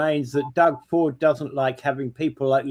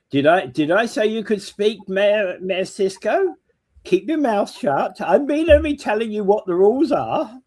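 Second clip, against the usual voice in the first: bossy chair; 6 semitones higher than usual. Pretend. Mock chair scolding a mayor.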